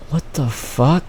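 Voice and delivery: soft, stunned reaction; retold disbelief. Student reacting to teacher at their ear, quietly stunned. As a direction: say it under your breath, baffled.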